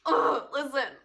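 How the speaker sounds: loud, high exasperated groan into words. Retold or imitated. Teen fed up with disbelieving mom, loud worked-up exasperation. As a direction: act out being fed up, loudly. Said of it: "how worked up I was getting".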